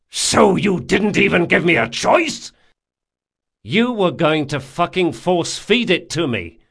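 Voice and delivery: high, loud, hurt yelling; angry accusation. A narrator's reading. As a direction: hurl the accusation at him. Jean accusing Locke.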